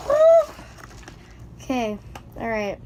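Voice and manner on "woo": loud high cheer of delight; real whoop of unboxing joy; excited woo. Real, not acted. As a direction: let out a thrilled whoop.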